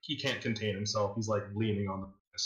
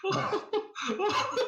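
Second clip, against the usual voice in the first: very high, laughing through every word; a cruel host who can't stop laughing; hysterical villain laughter. Acted.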